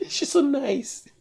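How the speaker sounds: high, soft, laughing through the words; amused disbelief. Real, not acted. Host laughing to himself about the caller's apology, high and soft. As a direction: chuckle in disbelief at her apology. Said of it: "She's sorry for me hollering".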